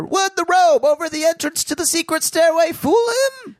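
put-on high, loud old radio narrator voice; big dramatic serial announcer; 1950s narrator. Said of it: "nineteen fifties narrator".

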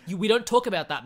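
lightly acted stern relative shutting it down; stern refusal. Mama Imelda refusing to discuss that man, lightly acted scolding. Acting out grandmother forbidding the topic.

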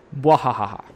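evil cackle, hammed up; mad scientist. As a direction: cackle like a cartoon villain. Villain laugh at own joke.

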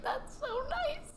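slow, soft, choked-up laugh; crying and laughing at once; tearful laugh. Real, not acted. Moved to tears by a stranger's kindness, laugh-crying. Retelling touching moment, tearing up.

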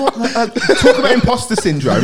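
giggling in disbelief on stage beside Michael Johnson; laughing disbelief. Real, not acted. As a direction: laugh it out, dazed at being there. Imposter syndrome on stage.

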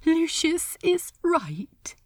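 usual speaking voice

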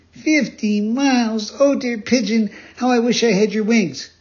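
high, loud exclaiming; dismayed puppet boy. Acted. Pinocchio longing for the pigeon's wings, high and dismayed.

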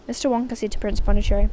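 ordinary delivery

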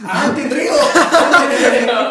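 loud, slow, laughing exclamation. Real, not acted. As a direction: belt it out through laughter. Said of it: "Descrivi il movimento".

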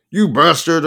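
loud, high angry yell; screamed. A narrator's reading. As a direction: yell the insult down the phone. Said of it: "I screamed".

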